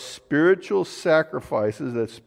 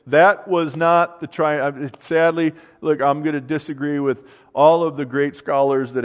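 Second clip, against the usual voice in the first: louder, insistent preacher's declaration; firm, raised preaching voice; emphatic preaching; 2 semitones higher, 5 dB louder than usual. Acted. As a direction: drive the point home against the scholars. Emphatic correction of scholars.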